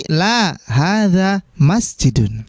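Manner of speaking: slow, deliberate Arabic model reading; syllable by syllable teacher model. Shown as a demonstration. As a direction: read it out slowly for the class to repeat. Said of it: "silakan ulangi lagi".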